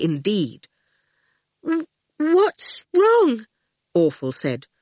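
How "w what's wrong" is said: frightened stammer. A narrator's reading.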